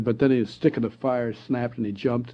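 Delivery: usual speaking voice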